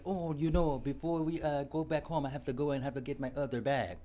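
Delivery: high mock Jamaican accent; sloppy Jamaican accent, high pitched. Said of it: "What accent is this?".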